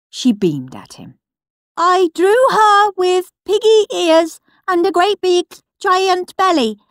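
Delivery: high and loud proud reading; big loud voice. Acted. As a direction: read out proudly to the whole hall. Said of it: "In a big, loud voice".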